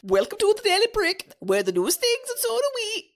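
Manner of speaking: put-on Jamaican accent. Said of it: "do I sound a bit Jamaican".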